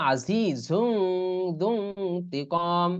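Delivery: very slow, long-held recital; Quran recitation. Shown as a demonstration. Teacher recites the example slowly for students. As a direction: stretch the nasal sound long. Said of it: "ইফা করে পড়তে হয়".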